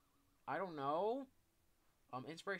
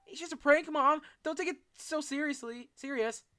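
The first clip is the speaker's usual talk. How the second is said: high, loud frantic pleading; panicked yelling; 13 semitones higher, 12 dB louder than usual. Pretend. Pleading with Mom.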